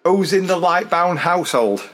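ordinary delivery

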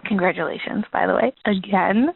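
ordinary talk, nothing marked

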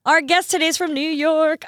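high, sing-out excitement; excited announcement. Real, not acted. Gleeful announcement of guest.

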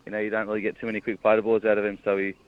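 ordinary delivery

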